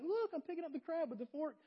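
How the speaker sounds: high-pitched mock child voice; excited schoolkid. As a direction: show off like a thrilled second-grader. Acting out delighted schoolkid showing off.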